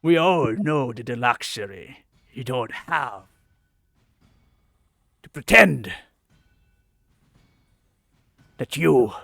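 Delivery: sly, taunting goblin captain; mock them with slow scorn; gravelly sly hobgoblin.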